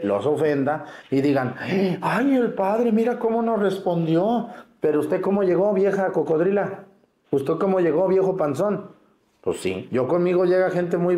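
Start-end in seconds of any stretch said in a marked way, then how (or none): 2.0-4.5 high-pitched, put-on whine of outrage; mock indignant complaint. Priest mimics parishioners grumbling about the padre, mock indignant. 4.8-9.0 lightly exasperated, higher-pitched comeback; mock scolding retort. Priest answering a complaining old parishioner, mock scolding.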